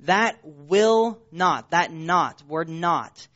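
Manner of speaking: "not" punched, "That will not" said very slowly; slow, deliberate weight on "not"; stress on not. Shown as a demonstration. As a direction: drive home the word "not". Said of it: "is in the emphatic negative in the Greek".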